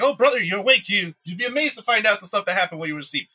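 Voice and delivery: high, loud, fast fake cheer; high-pitched mock-friendly brother; sly brother voice.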